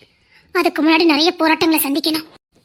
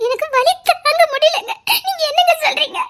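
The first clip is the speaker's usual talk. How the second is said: wailing in pain; 10 semitones higher than usual. Acted. Pregnant wife telling her husband the pain is unbearable, wailing. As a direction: cry out each word as the pain hits.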